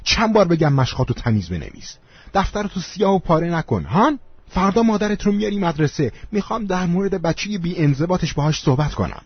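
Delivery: high-pitched teacher's telling-off; exasperated, acted schoolteacher rant; angry scolding. A narrator's reading. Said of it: "داد زد".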